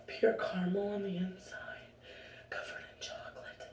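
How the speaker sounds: soft unvoiced whisper savoring it; dreamy whisper. Describing a favorite candy bar, indulgent whisper. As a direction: whisper as if tasting it.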